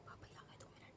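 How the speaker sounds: quick whispered aside to dad, barely voiced; real whisper aside. Real, not acted. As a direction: whisper it so the camera barely catches it.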